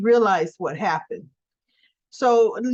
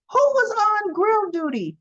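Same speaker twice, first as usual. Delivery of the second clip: mock indignant demand; 10 semitones higher than usual. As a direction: imitate someone demanding to know the culprit. Acted Olivia demanding who is responsible.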